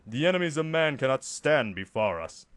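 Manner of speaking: loud, commanding soldier; Space Marine voice. Shown as a demonstration. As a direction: bellow it like an armored warrior. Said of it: "That was really bad".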